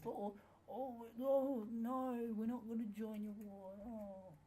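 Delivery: high, loud mocking voice; mocking the ally as a whiny nation; mock whiny refusal. Refusing ally's war call.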